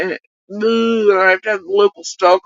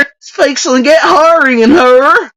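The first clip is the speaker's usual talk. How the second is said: hammed-up hillbilly accent; mock doofus accent; 8 semitones higher, 8 dB louder than usual. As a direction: parrot it in a doofus country accent. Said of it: "doofus accent".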